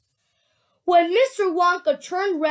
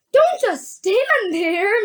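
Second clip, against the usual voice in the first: slow, high, loud demand; screaming; 6 semitones higher, 5 dB louder, much slower than usual. A narrator's reading. Mrs. Gloop screaming at her husband, high and drawn out. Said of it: "Missus Gloop screamed".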